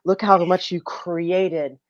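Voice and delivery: soft, hushed adoring words; whispered worship of wife; tender praise. Pretend. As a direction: murmur it to a goddess. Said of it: "you turn to her and you go".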